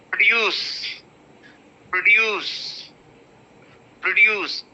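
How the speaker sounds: Produce with a bare pr, stress on DUCE; pr not pro. Shown as a demonstration. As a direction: slur pro into pr, land on DUCE. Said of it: "It is not pro exactly".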